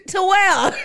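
high laughing burst. Real, not acted. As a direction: crack up mid-question.

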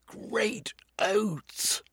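high breathy exclamation; startled cry. Acted. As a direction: gasp it out in shock. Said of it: "he cried".

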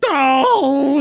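high, drawn-out "no", imitating despair; hammed-up high cry of frustration; mock anguished cry. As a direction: wail it as a cartoon dad's outburst. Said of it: "and starts slamming the wall".

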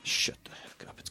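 hissed mock retort; whispered. Real, not acted. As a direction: whisper it sharply.

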